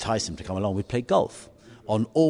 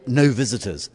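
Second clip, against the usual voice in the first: NO VISITORS punched out louder; emphatic sign-reading; 3 semitones higher, 6 dB louder than usual. Retold or imitated. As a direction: read "no visitors" like it ends in an exclamation mark. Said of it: "exclamation mark".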